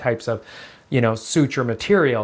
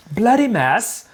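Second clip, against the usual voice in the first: "Bloody mess" said higher in a crisper mock British accent; a second, higher try at British "Bloody mess"; better British accent; 7 semitones higher, slower than usual. Host retrying the British accent after a bad first go.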